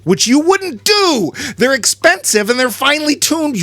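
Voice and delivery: high-pitched exasperated protest; worked-up nerdy indignation; indignant. Real, not acted. Mock outrage over misused lutes.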